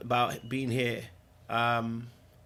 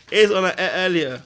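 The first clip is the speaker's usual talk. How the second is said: loud, higher, TV-chef "Here's one I ate earlier"; mock TV cook; 5 semitones higher, 9 dB louder than usual. Real, not acted. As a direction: present it like a TV cook. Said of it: "repeat, TV cook style".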